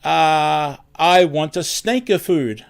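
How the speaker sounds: light imitation of a heavy Italian accent; a hint of a heavy Italian accent. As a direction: mimic a customer's thick Italian accent, mildly. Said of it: "really heavy, heavy accent".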